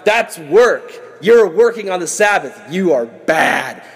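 put-on pompous condemnation; hammed-up self-righteous judge; judgmental Pharisee. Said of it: "That's what the Pharisees would do".

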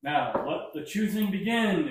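high, loud grand proclamation; booming announcer. Acted. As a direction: announce it to the whole tent. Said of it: "Mister Light says".

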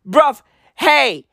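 usual speaking voice

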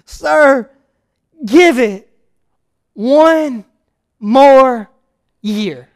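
a hint of begging, slow and spaced; pleading. Gardener begging his master, a touch of pleading. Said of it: "he answered pleading".

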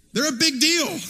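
ordinary delivery

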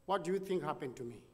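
ordinary delivery

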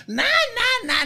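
high-pitched mock babble; in-character chatter. Comedian launching into character for the diva, in-character chatter. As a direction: jump up high and prattle.

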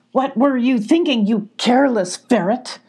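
hollering badger. Badger scolding the ferret for talking to strangers, hollering. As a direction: yell at the careless ferret. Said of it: "hollered the badger".

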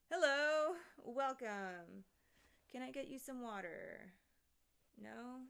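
loud welcoming greeting to a stranger; mock hostess. Greeting the neighbor who fell through the ceiling, hammed-up host. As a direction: play the host to someone falling through the ceiling. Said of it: "I'm just gonna be like".

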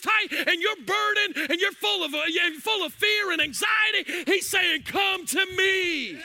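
rising, shouted preaching over music; full-force preaching. Acted. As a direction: preach it hard and loud, building.

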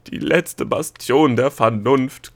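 loud, drawn-out croak. A narrator's reading. Nachtmar scoffing at the official's claim to reason, croaking. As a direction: croak it slowly and forcefully. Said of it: "krächzte der Mach".

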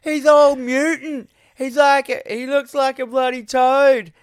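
high, loud drunken mockery; ragging on someone. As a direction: jeer in a hammed-up drunk voice. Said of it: "he starts ragging on the".

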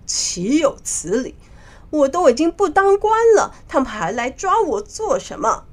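indignant, rising in the middle; loud indignation. Acted. Monkey King hears of his arrest, loudly indignant. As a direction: protest out loud, mildly outraged. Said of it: "大声地说".

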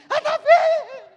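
high, loud cry through laughter; hammed-up panicked mother call, laughing; frantic mother laughing. Preacher playing the panicked mother while laughing, high and loud. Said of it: "Aí fez a curva assim".

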